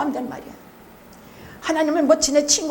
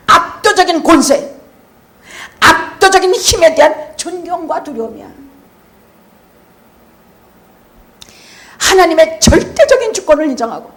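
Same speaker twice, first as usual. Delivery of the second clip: preacher pounding the point home; full-force preaching; 5 semitones higher, 9 dB louder than usual. Real, not acted. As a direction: thunder it from the pulpit.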